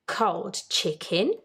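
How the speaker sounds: plain reading voice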